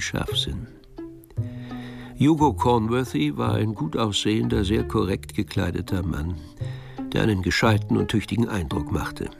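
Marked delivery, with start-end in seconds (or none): none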